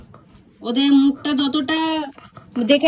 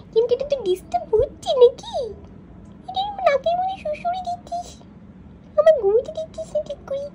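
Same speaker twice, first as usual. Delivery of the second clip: much higher squeaky pet voice, grumbling; sleepy dog's voice; 5 dB quieter than usual. Pretend. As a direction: speak for the drowsy puppy in a tiny high voice. Voicing the sleeping dog's complaint.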